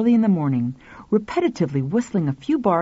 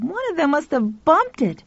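put-on boy voice; 8 semitones higher than usual. Pal voiced.

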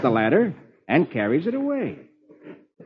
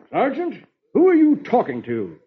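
higher-pitched questioning officer; puzzled officer; 8 semitones higher than usual. Acted. Officer finds sergeant talking to himself, puzzled.

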